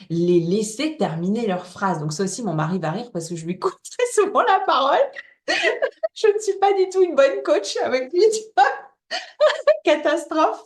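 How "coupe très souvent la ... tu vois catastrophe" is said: parler en riant, voix qui monte; aveu amusé. Real, not acted. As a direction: avouer son défaut entre de vrais éclats de rire. Avoue en riant son propre défaut. (en riant franchement, voix perchée)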